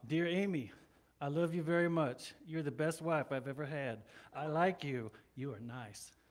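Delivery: mock love note in a low, stilted monotone; rote recitation. Husband answers his wife with the same words, mechanical recital. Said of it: "responded to Amy with the same thing every time".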